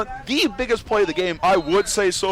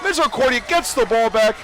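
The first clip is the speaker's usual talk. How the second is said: excited play-by-play; 6 semitones higher than usual. Real, not acted. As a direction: call the interception at full pitch. Live interception play-by-play.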